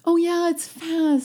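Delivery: sudden delighted recall of the name; bright gasp of recognition; remembering. Real, not acted.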